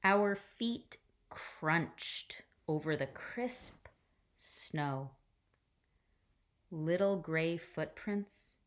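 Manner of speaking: gentle read-aloud storytelling voice; hushed, careful picture-book reading; story narration. A narrator's reading.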